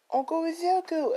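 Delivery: put-on very high child's wail for his uncle; boy thrown into a cell, calling his uncle; child crying out. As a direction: call out desperately, like a little boy.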